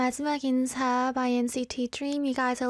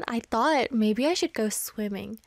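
ordinary talk, nothing marked

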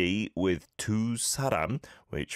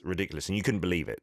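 normal talking voice

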